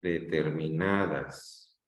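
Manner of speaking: "Determinadas" low and slow, lifting on long "na"; stressed syllable drawn out. Shown as a demonstration. Teacher asking which syllable is strongest, "Determinadas" stressed on "na". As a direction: stretch de-ter-mi-NAAA-das, falling at the end. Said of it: "Dónde está la sílaba de mayor intensidad".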